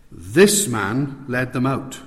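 This hit hard, slow and deliberate; preacher stressing This Moses, this man; emphatic stress on This. Shown as a demonstration. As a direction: lean on each This with a pause after it. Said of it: "I can emphasize it like this".

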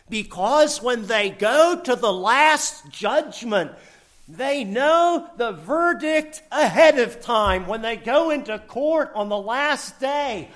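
slowing and laughing at the end; joyful preaching. Real, not acted. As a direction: celebrate the verdict.

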